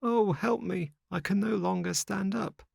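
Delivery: very high, distressed begging; panicked and failing, pitched right up; helpless plea. Acted. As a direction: plead weakly in a high voice. Stricken Lampwick begs for help.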